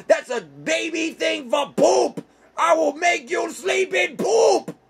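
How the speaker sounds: high, furious screamed threats; furious screaming. Acted. Teddy bear screaming threats at his rival, high and furious. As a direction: scream the revenge threats at Ted.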